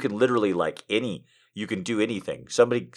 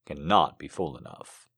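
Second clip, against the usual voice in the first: poem reading; 7 dB louder, slower than usual. Acted. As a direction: let each image land gently. Streamer reads a poem aloud.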